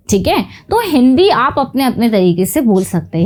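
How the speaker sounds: ordinary talk, nothing marked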